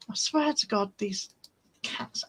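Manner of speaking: ordinary delivery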